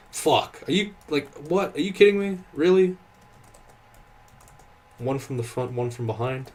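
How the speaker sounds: sudden high, loud yell of disbelief at the game; real rage at a nasty surprise; frustrated outburst. Real, not acted. Double trolls ambush in game.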